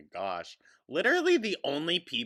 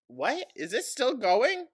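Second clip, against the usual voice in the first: surprised; 13 semitones higher, 7 dB louder than usual. Real, not acted. Mock disbelief.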